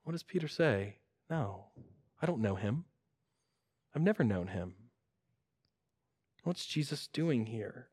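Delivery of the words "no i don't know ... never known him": low, very soft quoted denial; subdued denial. Retold or imitated. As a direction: play Peter denying it under his breath. Peter's denial acted out.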